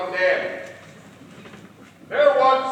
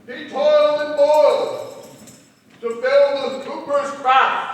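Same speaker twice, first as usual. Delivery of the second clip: poem recitation; 10 semitones higher, 9 dB louder than usual. Acted. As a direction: recite it like a ballad.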